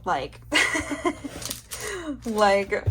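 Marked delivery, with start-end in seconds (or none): 0.5-2.2 high, breathy giggle. Real, not acted. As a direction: giggle at the cute bunnies. Giggling about bunnies.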